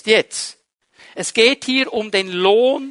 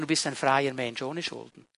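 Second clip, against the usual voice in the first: gently corrected, hushed and lower; softer, lower correction; 7 semitones lower, 10 dB quieter than usual. Shown as a demonstration. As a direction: lower and softer, with stress on freier.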